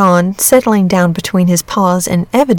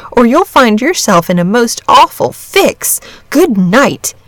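lively, emphatic youngster losing patience; voiced boy delivering a parting warning; exasperated boy; 3 semitones higher than usual.

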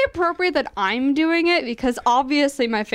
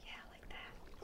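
soft unvoiced whisper; 30 dB quieter than usual. Shown as a demonstration. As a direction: breathe it with no voice. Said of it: "read unmiked?".

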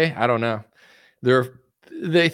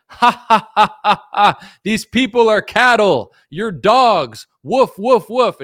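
hammed-up sneering rich man, laughing, higher and louder; a cackling elite mocking the masses, slow and loud; 8 semitones higher, 7 dB louder, slower than usual. Elites in ivory towers mocking the public, sneering cackle. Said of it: "in their ivory towers being like".

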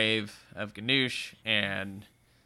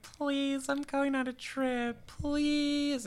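much higher, soft whiny mock begging; 11 semitones higher, 7 dB quieter, much slower than usual. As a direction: wheedle the clerk. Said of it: "She's like".

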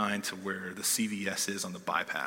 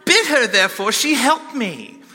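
put-on British accent, laughing; 14 semitones higher, 14 dB louder than usual. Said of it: "a little British accent".

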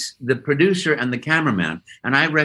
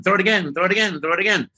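higher, rapid-fire begging like a dog after a stick; eager dog; 7 semitones higher, faster than usual. Acted. Actor likens himself to a dog chasing a stick, eager begging. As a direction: play an eager dog wanting another throw. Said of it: "it's a dog chasing a stick".

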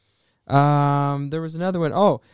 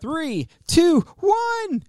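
high, drawn-out countdown; time-travel countdown, voice soaring high; countdown call; much slower than usual. Pretend. Counting down the flashback's return.

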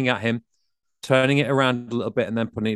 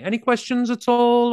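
high, timid any questions; high weak question; 12 semitones higher, 4 dB louder than usual. Retold or imitated. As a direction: ask it up high, unsure. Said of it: "rather than saying".

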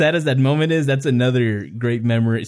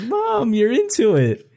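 much higher squeaky teasing; teasing brother; 11 semitones higher, slower than usual. Retold or imitated. Brother teasing mom about liking it, high playful. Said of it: "He's like".

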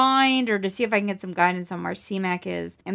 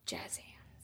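barely audible whispered aside; 25 dB quieter than usual. Pretend. Player adds to Max's dramatic gesture, whispered. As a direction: whisper it as a little stage effect.

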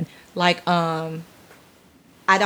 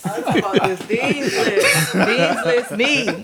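laughing mock; 4 semitones higher, slower than usual. Mocking a dean's-list student.

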